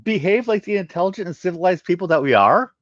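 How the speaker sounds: high, loud scornful interjection; sharp retort. Acted. Bakarian woman cutting in to scold the crowd. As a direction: cut in with disdain. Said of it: "a female voice interjected".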